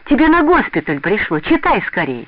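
high, loud urgent announcement; excited calling out. A narrator's reading. Aunt brings a letter from the doorway, calling excitedly. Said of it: "крикнула она ещё с порога".

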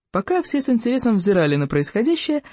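ordinary delivery, nothing marked

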